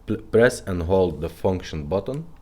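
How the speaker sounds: usual speaking voice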